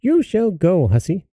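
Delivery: high, scolding mother voice; insistent mother. A narrator's reading. Mother ordering her minx of a daughter, insistent. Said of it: "said the mother".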